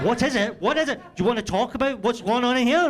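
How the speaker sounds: hammed-up accusing yell; mock accusing shout. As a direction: demand an answer, half laughing. Mock interrogating wife about smell.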